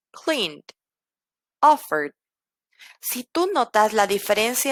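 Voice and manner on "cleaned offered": "cleaned, offered" ending in a plain D without E. Shown as a demonstration. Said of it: "E D es únicamente una D".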